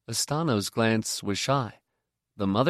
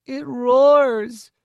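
put-on much higher and louder, a small child's wail; plaintive little boy; 8 dB louder than usual. Dazed little Miv complains to the mother, plaintive and high. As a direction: whimper it like a hurt toddler. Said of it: "he said plaintively".

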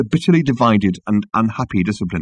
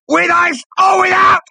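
high, loud bellowed question; strained, very high shout; enraged bellow; 5 dB louder than usual. A narrator's reading. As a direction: bellow it like an interrogation. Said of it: "bellowed number two".

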